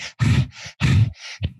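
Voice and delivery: imitated heavy panting; acted gasps for breath. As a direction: puff as if winded from sprinting. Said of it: "She's out of breath".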